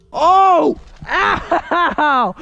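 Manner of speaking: sharp yelps of pain; hit hard crying out. Real, not acted. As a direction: yelp when struck. Getting lit up.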